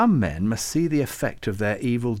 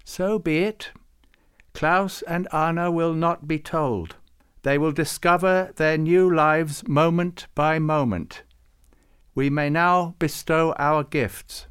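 higher, slow and solemn intoning; 6 semitones higher, 4 dB louder than usual. Acted. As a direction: pronounce it like a ritual. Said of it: "he used his intoning voice again".

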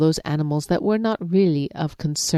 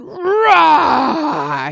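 loud, much higher lion roar; lion diving at reflection with a roar; 10 semitones higher, 9 dB louder than usual. Acted. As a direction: let out a full lion roar. Lion roars.

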